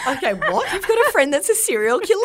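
high laughing shock; laughing disbelief. Real, not acted.

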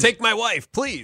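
put-on hammy old-time comic delivery; old borscht belt comic. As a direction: deliver it like a Catskills stand-up. Classic comic one-liner.

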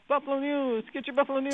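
high street-seller call; newsboy calling. Pretend. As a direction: hawk the paper on the corner. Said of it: "Town crier".

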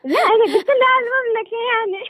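high, laughing through words. Real, not acted. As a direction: giggle while explaining. Caller laughs at teasing.

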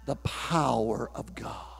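low, soft and slow: "the power of God"; hushed. Acted. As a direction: drop right down, almost breathing it. Preacher drops to hush.

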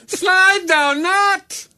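loud, high, drawn-out British proclamation; slow, piercingly high theatrical British voice; mock British accent. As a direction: declaim it in a hammed-up archaic British accent. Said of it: "speaking in British accent".

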